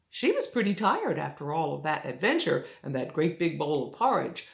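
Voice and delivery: ordinary delivery, nothing marked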